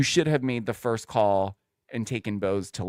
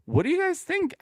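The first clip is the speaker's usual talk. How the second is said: playful high question. Real, not acted.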